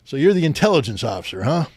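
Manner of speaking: a touch of the commanding officer sizing him up; lightly voiced colonel questioning a new lieutenant; imitating the colonel. Colonel's voice in a retold meeting.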